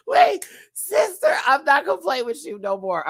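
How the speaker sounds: high, loud squeal settling down; laughing tease. Real, not acted. Host teasing a sister, high giggly squeal. Still cracking up while teasing.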